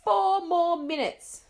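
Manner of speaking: loud, slow, drawn-out warning; mother counting down at the door; shouted countdown. Acted. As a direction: stretch out the countdown loudly. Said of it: "shouted her mother".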